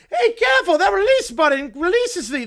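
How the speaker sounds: loud, high alarmed villain yelp; panicked cartoon villain. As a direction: scold the platypus in mock panic. Villain reacts to Perry attacking.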